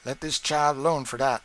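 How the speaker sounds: loud cheeky promise; Sam boasting. A narrator's reading. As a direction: brag you've got it handled. Sam reassures mistress.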